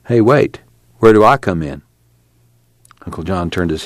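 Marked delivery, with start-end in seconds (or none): none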